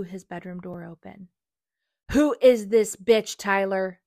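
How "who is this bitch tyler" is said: slow, very loud furious demand; jealous fury at full volume; enraged scream. Acted. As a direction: scream it, shaking with rage. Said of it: "shaking with rage".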